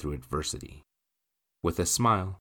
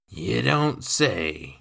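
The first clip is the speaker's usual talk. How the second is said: very slow, drawn-out reply; slow, louder, raised drawl; intrigued drawl; 4 semitones higher, 6 dB louder, much slower than usual. Acted. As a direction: stretch it out with sly interest. Drunkard takes interest in news of money.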